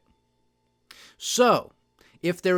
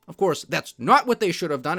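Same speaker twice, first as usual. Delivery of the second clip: ordinary talk, nothing marked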